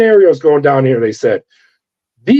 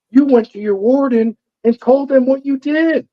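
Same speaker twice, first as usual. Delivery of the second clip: much higher mimic of the woman agent, accusing; accusing female agent; 10 semitones higher than usual. Female FBI agent confronting the officer, much higher mocking voice. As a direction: pitch up to play her as she gets in his face.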